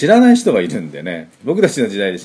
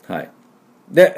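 usual speaking voice

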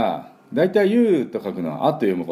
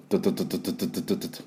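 rapid softer 「トゥトゥトゥ」 like a construction drill; drill-like tu sounds; 6 dB quieter, faster than usual. Shown as a demonstration. Teacher imitates a drill for the D sound. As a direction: fire off short 「トゥ」 bursts fast. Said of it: "工事現場のドリルの音".

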